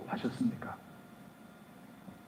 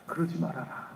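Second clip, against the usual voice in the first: hushed plea; 12 dB quieter than usual. Acted. Moses pleading face down.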